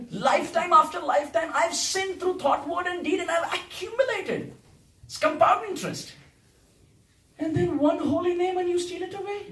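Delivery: high-pitched, mock-indignant accusation; sarcastic complaint. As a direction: plead your loss in playful outrage. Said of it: "This whole song is sarcastic".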